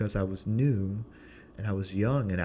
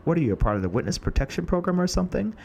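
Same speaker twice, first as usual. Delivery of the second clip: teasing director; 9 semitones higher, 4 dB louder, much faster than usual. Retold or imitated. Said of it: "and she goes".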